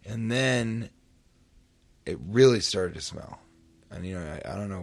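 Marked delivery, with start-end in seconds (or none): none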